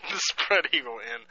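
slow, laughing disbelief. Real, not acted. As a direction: savor the name through a laugh. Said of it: "It's funny".

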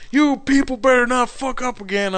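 angry caller. Retold or imitated. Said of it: "He's like".

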